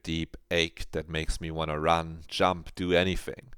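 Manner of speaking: normal talking voice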